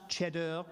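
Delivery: "cheddar" said as if it were French; Frenchify "cheddar" with a stretched last syllable; mock-French pronunciation. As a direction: pompously correct "cheddar" into a fake French word. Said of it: "correct someone's pronunciation of a cheese".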